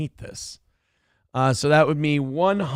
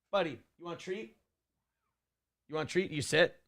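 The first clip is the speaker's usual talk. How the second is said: soft, fast coaxing of the dog; pet talk; 14 dB quieter, much faster than usual. Real, not acted. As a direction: coax the dog gently with a treat. Offering the dog a treat.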